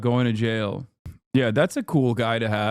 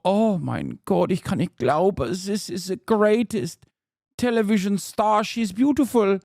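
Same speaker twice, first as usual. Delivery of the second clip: gushing German fan, pitched higher; German accent; 6 semitones higher than usual. Acted. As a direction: gush in a mock German accent. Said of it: "Germans look at an accountant wearing a turtleneck and go".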